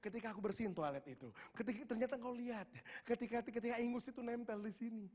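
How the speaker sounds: high-pitched, swelling cry to God; retold outpouring, pitched high and building; overflowing emotion. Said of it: "meluap semuanya".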